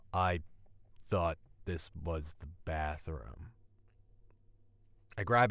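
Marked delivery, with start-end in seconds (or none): 0.1-3.5 lightly voiced drunk, low and halting; drunk roommate. Drunk roommate explains himself to the couple, lightly voiced low drunk. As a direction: say it soft and low, like a mildly befuddled drunk. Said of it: "It's my drunk roommate".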